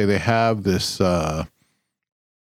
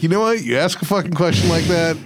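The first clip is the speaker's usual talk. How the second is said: high, pushed up mock outrage at the cashier; play-scolding the worker, voice way up; mock indignant rant; 9 semitones higher, faster than usual. To his wife, retelling his fake indignant comeback at the cashier.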